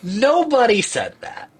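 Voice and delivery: high, drawn-out incredulous "nobody"; emphatic disbelief. Real, not acted. Player scoffing at the upside-down castle twist, emphatic disbelief. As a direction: stretch each "nobody" up high, half laughing.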